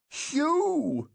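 very high, drawn-out cry; panicked shriek. A narrator's reading. Mother calling to her climbing son, panicked shriek. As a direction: shriek for your child in alarm. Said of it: "Caroline shrieked".

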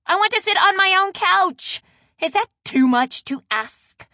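loud, high indignant tirade; worked-up, exasperated scolding; voice rising in outrage. A narrator's reading. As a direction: rant at the culprit, voice shooting up. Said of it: "Maddie's voice rose an octave".

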